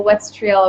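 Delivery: "real" slipped into "treal", with a T-R start; accidental word blend. Real, not acted. Coach stumbles quoting a client check-in, blended word. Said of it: "That's a new word".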